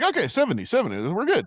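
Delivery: high, excited imitation with a laugh; mock eager agreement. Friend settling the speed for the jump, mock eager agreement. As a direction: blurt it high, half-laughing.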